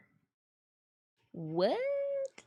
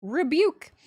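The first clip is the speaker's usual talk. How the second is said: mock rebuke; 6 semitones higher, 10 dB louder than usual.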